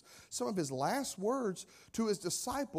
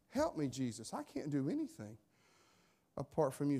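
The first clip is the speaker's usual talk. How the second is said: soft, lower, humble prayer; quiet plea; 4 semitones lower, 5 dB quieter than usual. Pretend. Believer humbly asking Jesus for help, soft and low. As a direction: pray it under your breath.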